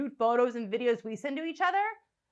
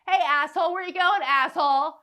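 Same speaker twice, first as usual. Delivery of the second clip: loud, higher-pitched catcall; mock heckling; 4 semitones higher, 5 dB louder than usual. Comic harassing a man down the street, heckling loudly.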